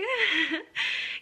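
loud, high derisive laugh; mocking laugh. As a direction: burst out laughing at the listener. Mocking the listener.

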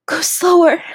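high, loud gasping plea; strained high-pitched plea; gasped plea. Acted. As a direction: beg loudly on a gasp. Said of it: "I gasped".

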